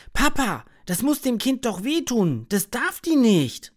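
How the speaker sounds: put-on high, loud child's outcry; loud call. A narrator's reading. Max calls out loudly to the scolding mother. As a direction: cry out to stop the mother. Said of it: "Max ruft auf einmal ganz laut".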